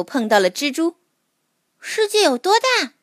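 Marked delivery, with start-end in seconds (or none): 1.8-2.9 put-on high, curious child voice; little mole voice. A narrator's reading. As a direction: ask like a small wide-eyed creature. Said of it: "它问蜘蛛".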